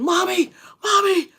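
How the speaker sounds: high, loud desperate cry; screaming for mommy. Retold or imitated. Son calling his mother in fear, high and loud.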